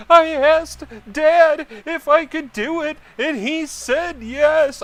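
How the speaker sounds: put-on very high, loud, drawn-out kid shriek; mock tattling kid. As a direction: mimic a child yelling the blame onto Dad. Mimics kid blaming dad, shouting.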